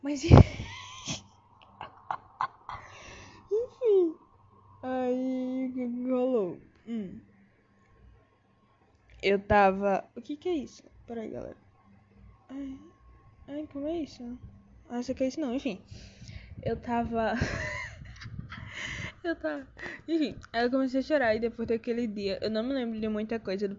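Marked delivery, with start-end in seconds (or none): 0.3-1.2 sudden high shrieking laugh; loud laugh. Real, not acted. Laughing out loud at her own story. 17.4-19.2 high airy wheeze of laughter; breathless laugh. Real, not acted. Cracking up while telling her story.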